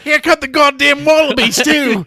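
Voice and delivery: loud, high hammed-up Australian exclamation; mock Australian accent. Gamer joking about wallabies, in a loud mock Australian accent. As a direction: shout it like a cartoon Aussie outback bloke. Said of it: "Your accent is terrible".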